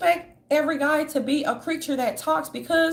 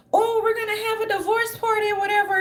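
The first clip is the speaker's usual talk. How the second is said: high mocking fan voice, pitched way up; 8 semitones higher than usual. Online fans gushing about a divorce party, high mocking voice. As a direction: sneer it up high like a giddy follower. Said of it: "they're like".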